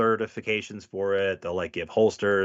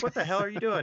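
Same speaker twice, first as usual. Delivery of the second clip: high, disbelieving what-the-hell; mock outrage at a coworker's odd gun; incredulous; 13 semitones higher, faster than usual. Cop asks a coworker, in high disbelief. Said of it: "you're just like".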